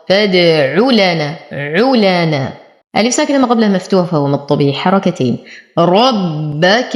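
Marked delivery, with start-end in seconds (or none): 0.1-2.5 فادع لنا slow, with brief "u" after ع; measured فادع لنا, no lengthening before لنا; short damma only. Shown as a demonstration. Teacher repeating the fix, one short "u" in فادع لنا. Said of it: "زمن ضمة فقط". 5.8-7.0 ربك with heavy ر and held double ب; slow ربك, press and hold the ب; doubled bāʾ, heavy rāʾ. Shown as a demonstration. Teacher showing shadda, heavy ر and doubled ب in ربك. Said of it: "أشدد الباء مع تفخيم الراء".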